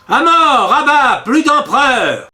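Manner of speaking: chanting mob calling for death; mob shout. A narrator's reading. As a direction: hurl it like a furious crowd. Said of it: "Cent voix criaient".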